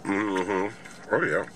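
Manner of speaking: chewing while talking; muffled by food; mouth full of burger. Real, not acted. Seen 1: Bites burger at -4; jaw still working, burger in hand while speaking.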